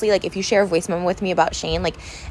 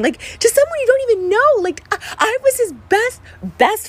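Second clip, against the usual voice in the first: much higher, distressed protest; upset outburst; 12 semitones higher than usual. Real, not acted. Vlogger protesting to her absent best friend, high upset outburst. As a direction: voice climbing high in hurt indignation.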